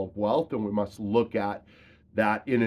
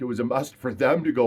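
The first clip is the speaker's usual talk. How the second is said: ordinary talk, nothing marked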